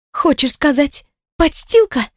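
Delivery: high-pitched, wounded challenge; hurt, offended. A narrator's reading. Woman offended at being called his girl, high and hurt. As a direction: throw it back at him, offended and high. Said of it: "прошипела она с обидой".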